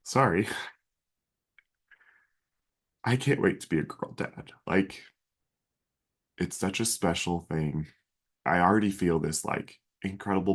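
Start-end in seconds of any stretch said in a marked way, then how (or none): 0.0-7.9 real emotion welling up, voice catching; fighting back tears as he speaks; getting emotional. Real, not acted. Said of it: "I'm probably gonna get emotional here".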